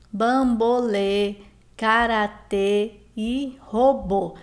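bambolê, karatê e robô slowly, syllable by syllable; slow word list, closed stressed endings on bambolê, karatê, robô; stressed final syllables. Shown as a demonstration. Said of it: "nós temos um som aí fechado". (bambolê, karatê e robô devagar, com finais tônicos e fechados)

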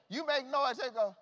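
loud, high preaching cry; preaching at full force. Acted. Preacher rousing the church about Lazarus, shouting. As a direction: whoop it to the congregation. Preacher driving home his point.

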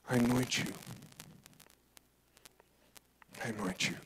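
soft, reverent and quiet; hushed anointing. Acted. Solemn anointing scene.

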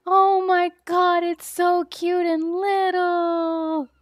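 high, loud, drawn-out squeal; gushing adoration. Real, not acted. Streamer seeing the baby pet, slow high gushing. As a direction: coo loudly, stretching every word slowly.

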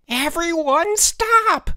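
loud, very high, drawn-out shriek; half-crazed. A narrator's reading. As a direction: shriek the command, frantic. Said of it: "Elmer's half-crazed voice".

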